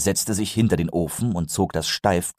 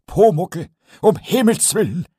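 put-on a higher, louder cry of a dismayed old carpenter; Eder calling out; 11 semitones higher, 5 dB louder than usual. Meister Eder cries out at the glued goblin, higher and louder. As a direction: exclaim in alarm. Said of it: "rief er".

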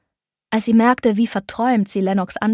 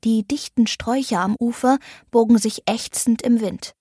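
usual speaking voice

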